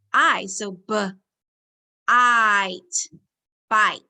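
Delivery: "b" then "ite" said apart, then joined as "bite"; sounding out. Shown as a demonstration. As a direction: blend "b" and "ite" slowly into "bite". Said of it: "does this I say I".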